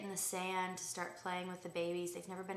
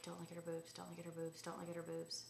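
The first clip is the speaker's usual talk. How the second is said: softer repeated inner reminder; muttered self-warning; 8 dB quieter, faster than usual. Retold or imitated. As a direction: tell yourself under your breath not to stare. Inner voice passing the woman.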